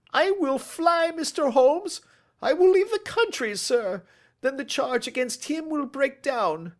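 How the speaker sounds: high, loud, panicked promise; frantic pleading. Acted. Cornered thief begs the detective to let him flee, panicked.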